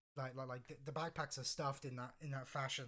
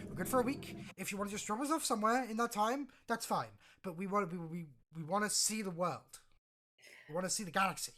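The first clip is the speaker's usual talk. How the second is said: raised, insistent pitch; higher, louder earnest appeal; villager pleading her case; 6 semitones higher, 7 dB louder than usual. Acted. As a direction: press on eagerly to be taken along. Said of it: "step forward at this point and say".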